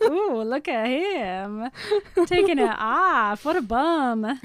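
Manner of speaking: slow, leering mock admiration; a lightly voiced Rosalie, teasing and slow; ogling Rosalie. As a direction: draw it out like she's savoring the view. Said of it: "Rosalie is not looking at you from the trees like".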